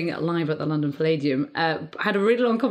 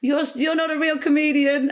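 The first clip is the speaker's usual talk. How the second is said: mocking jibe; 9 semitones higher, 3 dB louder than usual. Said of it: "stand-ups often make jokes which are".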